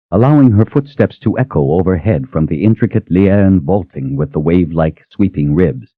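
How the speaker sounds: ordinary delivery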